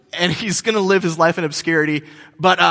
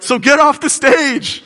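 slow, higher, laughing heckle; heckling; 9 semitones higher, 6 dB louder, slower than usual. Retold or imitated. Heckler laughing at the opener, mock jeer. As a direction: tease the opener off stage. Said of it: "someone like me will be like".

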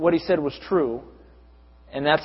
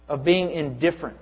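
ordinary talk, nothing marked

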